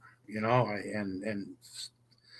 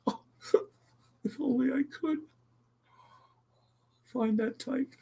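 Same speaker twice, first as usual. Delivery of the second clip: high, soft tearful sigh; tearful wish; 5 dB quieter than usual. Real, not acted. Streamer wishing for love, soft high tearful voice. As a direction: sigh it out through tears. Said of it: "I promised I wouldn't cry again".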